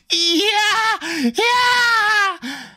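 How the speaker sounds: high, long inhuman scream; blood-curdling scream. A narrator's reading. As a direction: scream in raw terror. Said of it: "blood-curdling scream".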